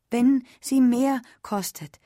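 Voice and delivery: lauter, höher und langsam, fast flehend; verzweifelt wiederholt. A narrator's reading. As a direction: ein letzter verzweifelter Anlauf, laut. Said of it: "begann Ellen zum dritten Mal". (zum dritten Mal, laut und verzweifelt flehend)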